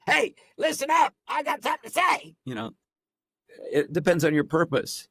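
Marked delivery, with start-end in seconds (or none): 0.0-2.3 cutting through. Shown as a demonstration. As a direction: cut through the room. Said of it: "maybe you wanna cut through".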